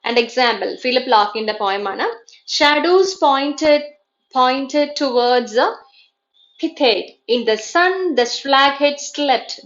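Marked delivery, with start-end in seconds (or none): 2.6-9.5 very slow, measured recitation with pauses; trochaic verse reading. Shown as a demonstration. Teacher reading a poem as a trochaic example, slow and emphatic. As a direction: stretch each beat, stressed then unstressed. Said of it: "trochaic-ിൽ വരുമ്പോൾ stressed followed by unstressed".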